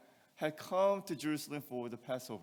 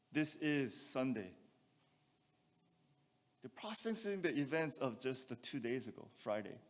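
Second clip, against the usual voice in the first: ordinary delivery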